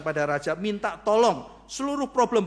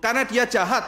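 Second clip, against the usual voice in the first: raised voice; 5 semitones higher, 7 dB louder than usual. Acted. Esther pleads before the king.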